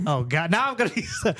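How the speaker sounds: ordinary talk, nothing marked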